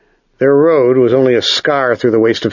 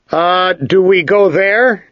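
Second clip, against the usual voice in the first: slow, higher-pitched asking; hesitant question; 7 semitones higher, slower than usual. A narrator's reading. Ged asks his guide about a distant tower, hesitant question. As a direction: ask it slowly, voice lifting.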